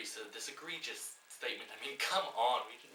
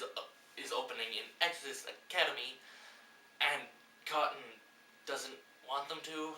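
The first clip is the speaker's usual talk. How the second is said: ordinary talk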